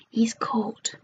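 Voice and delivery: ordinary delivery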